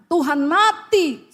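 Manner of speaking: slow, heavy stress on mati; a preacher slowing down to stress Tuhan mati; slow emphasis. Acted. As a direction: let mati land slowly and firmly. Preacher stresses Christ's death.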